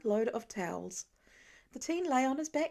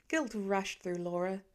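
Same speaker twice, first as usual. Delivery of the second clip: usual speaking voice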